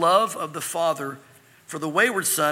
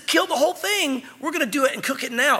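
higher-pitched, eager festive order; excited father; 6 semitones higher than usual. Acted. Father ordering the whole calf cooked, excited. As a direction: call for the feast with excitement.

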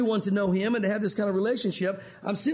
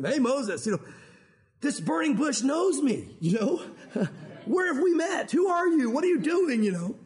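startled asking who's there; startled Moses; 4 semitones higher than usual. Retold or imitated. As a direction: act out Moses caught off guard. Moses startled at burning bush.

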